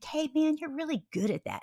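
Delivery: high-pitched encouraging praise; mock parental praise in a high voice; retold cheering.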